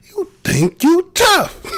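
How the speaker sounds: high, loud, drawn-out taunting; big high-pitched needling jab. Real, not acted. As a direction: challenge them loudly, stretching each word. Mocking challenge to someone off-mic.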